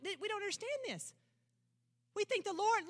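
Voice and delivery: ordinary talk, nothing marked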